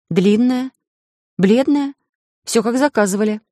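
teasing innocence. A narrator's reading. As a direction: list each feature with mock innocence. She teasingly presents requested dress. (с невинной издёвкой)

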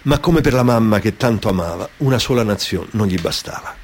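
plain reading voice

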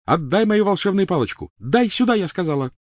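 high, loud demanding yell; frantic, high-pitched ordering; crazed fury. Acted. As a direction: demand it like a madwoman. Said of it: "Она была прям как безумная".

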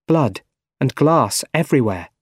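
ordinary delivery

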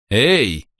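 high sharp icy hey; icy warning. Acted. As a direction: freeze him with one word. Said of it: "ледяным голосом Киры". (ледяное «эй»)

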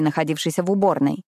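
usual speaking voice

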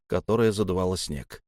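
usual speaking voice

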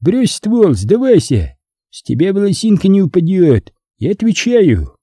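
high voice pitched across distance; high-pitched hail to an enemy; calling out. A narrator's reading. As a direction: coax him, calling from cover. Opponent calls on him to surrender.

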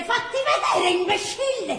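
put-on high, loud scornful jeer at the old man; insulting sneer. Girl jeers at the old man, loud and scornful. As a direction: spit the insult at him, half laughing. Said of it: "lei subito l'offende".

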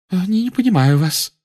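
high, stammering bewildered seer; stunned Trelawney. A narrator's reading. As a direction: caught off guard, stammering.

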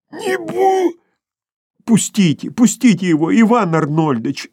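high, anxious plea; pleading. A narrator's reading. The two women beg Bormenthal to release Sharikov, anxiously pleading. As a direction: beg him to let go. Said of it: "взмолились".

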